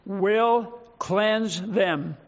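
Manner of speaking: "will cleanse them" very slow, each word set apart; "will cleanse them" in deliberate, separated words; emphasis on "will cleanse them". Shown as a demonstration.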